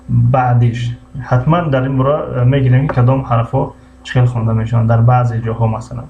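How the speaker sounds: usual speaking voice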